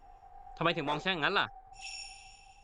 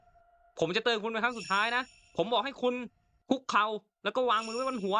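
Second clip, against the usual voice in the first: high, raised voice giving a last warning; final warning shout; 7 semitones higher than usual. Pretend. Player warning the woman one last time, stern shout. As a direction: bark the last warning like a cop. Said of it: "อันนี้เราก็ตะโกนนะครับ".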